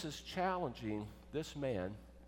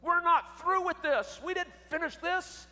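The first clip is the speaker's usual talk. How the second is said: high, loud yell, hammering each phrase; retold angry yell; 13 semitones higher, 6 dB louder than usual. Wife yelling at husband walking out after breakfast fight, angry yell. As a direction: yell it after someone walking away, much higher. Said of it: "I just yelled at him".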